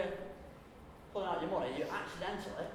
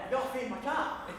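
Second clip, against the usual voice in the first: higher-pitched, hammed-up panicky excuse; mock panic; 5 semitones higher than usual. Repeating a feeble excuse to a date, mock panic. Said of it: "In your panic, you say it again".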